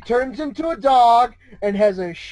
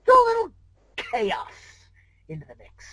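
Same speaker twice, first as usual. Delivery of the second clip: rising to chaos; 4 semitones higher than usual. Acted. Discord savoring the word chaos, swooping high then falling away. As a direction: voice swoops high on throw a little, then drops to a hush on the mix.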